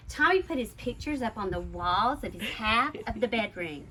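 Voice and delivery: usual speaking voice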